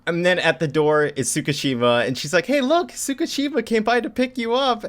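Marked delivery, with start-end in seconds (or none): 2.5-4.8 very high mock-cheerful call; cheery announcement. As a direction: imitate a woman sweetly announcing a visitor. Woman announcing a visitor, acted.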